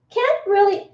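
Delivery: ordinary talk, nothing marked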